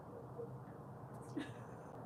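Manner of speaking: soft, breathy laugh; laughter. Real, not acted. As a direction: laugh quietly, nearly voiceless. Laughs at chat joke.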